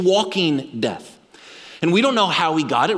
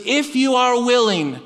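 slow, higher plea called out; shouting out; 6 semitones higher than usual. Acted. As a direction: cry out the plea slowly. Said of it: "he shouts out to Jesus".

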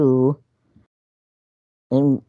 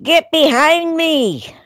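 rebuking the devil; 14 semitones higher, slower than usual. Acted. Rebuking the devil mid-story.

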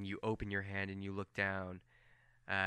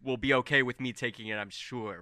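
ordinary delivery

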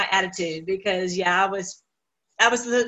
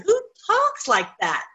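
amazed; 8 semitones higher than usual. Real, not acted. Amazed question, pitched high.

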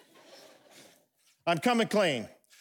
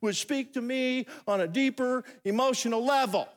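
high-pitched imitation of a wanting wife; mock complaining wife; 6 semitones higher than usual. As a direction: plead higher, as a parody of wives. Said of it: "Women, you're saying".

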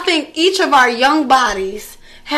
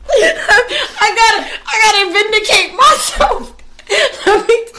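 higher, laughing as she insists; laughing protest; 6 semitones higher than usual. Real, not acted. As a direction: push through the giggles to defend herself. Laughing, defending herself.

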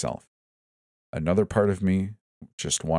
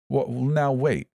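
high, drawn-out stammering protest; slow, high, panicky objection; frantic sputter; 7 semitones higher, slower than usual. A narrator's reading. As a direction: sputter to stall them. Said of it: "Jonathan sputtered frantically".

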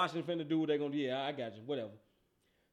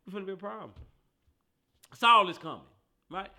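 ordinary delivery

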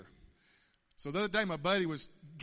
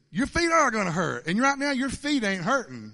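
raised, loud voice driving the point home; higher-pitched, forceful correction; emphatic retold rebuke; 6 semitones higher, 9 dB louder than usual. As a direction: lay it down loudly and firmly. Retold Lord scolding him firmly.

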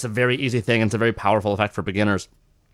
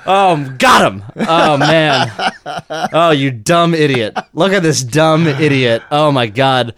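higher, louder, gloating magician; mocking magician; 4 semitones higher, 4 dB louder than usual. Magician ridicules the volunteer and his wife. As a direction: sneer at the volunteer for the crowd. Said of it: "that's how the joke feels".

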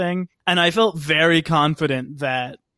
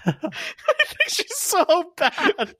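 laughing; 14 semitones higher, much slower than usual. Real, not acted. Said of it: "I think she's just awful in it".

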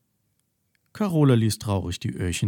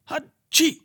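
very high squeaky sneeze; 3 dB louder than usual. A narrator's reading. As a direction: sneeze from a tickled nose. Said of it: "und nochmal".

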